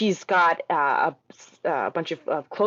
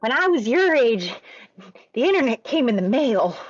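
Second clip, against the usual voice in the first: put-on old-timer voice; 6 semitones higher than usual. Old-timer bit about the internet.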